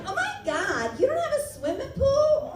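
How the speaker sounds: high-pitched, incredulous whine of mock horror; mock shocked Californian. Californian aghast at someone poor, mock shocked. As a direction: squeal in mock disbelief at someone's hardship.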